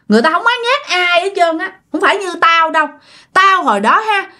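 high-pitched retold ghost, insistent but not shouting; storyteller's high ghost voice, airing grievances; possessing ghost's voice. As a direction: play a wronged spirit speaking through someone, pitched well up. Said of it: "nhập vô la hét rất là dữ".

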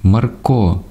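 high, urgent self-announcement; crying out. A narrator's reading. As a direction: call it out high, phrase by phrase. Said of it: "кричав зовсім інший голос".

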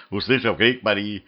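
plain reading voice

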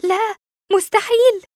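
high, loud disbelief; stunned outcry of denial; shocked gasp. A narrator's reading. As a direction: blurt out in shock. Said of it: "لهفت مصدومًا".

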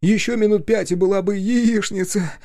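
very high, strained captive's voice; loudening relief on the edge of hysteria; high-pitched rescued man, near a nervous laugh; near-hysterical journalist. A narrator's reading. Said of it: "Короткий, почти истерический смешок вырвался".

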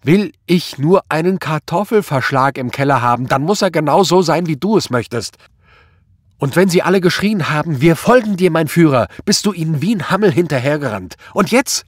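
loud, high furious tirade; screeching. A narrator's reading. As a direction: hurl accusations at him, screeching. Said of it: "kreischte sie". (wütend kreischende Vorwürfe)

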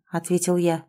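ordinary delivery, nothing marked

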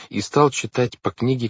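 ordinary delivery, nothing marked

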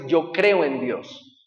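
very slow, leaning on creo; slow, emphatic quote. Acted. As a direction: spell out creo slowly. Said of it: "me va a decir".

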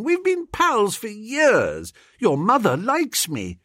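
high, coaxing argument; pleading. A narrator's reading. Narrator citing old friendship to Freddy, pleading. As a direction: coax him, voice lifted. Begging his pal to stay.

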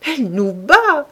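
put-on old man laughing at her blows; gleeful cry. A narrator's reading. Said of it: "répéta gaiement". (s'écrier gaiement, plus haut et plus fort)

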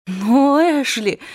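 high, breaking with dismay. A narrator's reading. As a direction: let the voice crack with disappointment. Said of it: "голос её срывался от волнения и разочарования".

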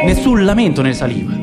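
usual speaking voice

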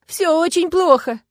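high, loud, slow wail; sobbing protest. A narrator's reading. Woman contradicts her comforter, acted sobbing. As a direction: wail it out, drawn out and tearful. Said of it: "рыдая, возразила я".

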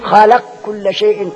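slow recitation of خلق كل شيء, the قاف merged into the كاف as xalakkul; tajweed merging demo; merged qaf into kaf. Shown as a demonstration. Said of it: "تدغم القاف في الكاف".

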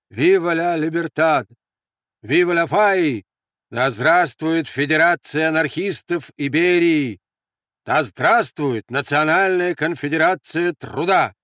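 loud, high, slow rallying cries; shouted slogans. Acted. Anarchist salutes exchanged across the barricade, shouting. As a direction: proclaim each salute, drawn out. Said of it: "Закричали в ответ".

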